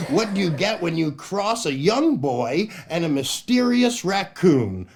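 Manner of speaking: ordinary delivery, nothing marked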